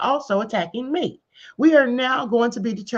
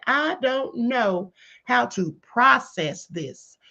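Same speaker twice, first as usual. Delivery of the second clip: slow, pointed repeat; much slower than usual. Retold or imitated. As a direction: drag out "I don't know how to process this" word by word. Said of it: "He didn't ask any of those questions".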